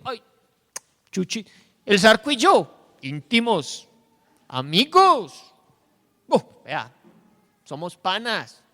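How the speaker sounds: a mock-proud buddy voice, loud; smug boasting. Preacher voicing a boastful man, mock pride. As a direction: brag about your famous friends.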